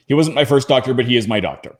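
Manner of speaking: ordinary talk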